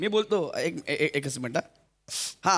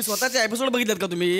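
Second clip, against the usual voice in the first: ordinary talk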